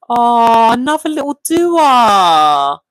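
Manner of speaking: slow, drawn-out adoring coo; cooing delight. Real, not acted. Streamer pictures another little Dua, cooing. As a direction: coo over a newborn, stretching each word. Cooing over viewer's new sibling.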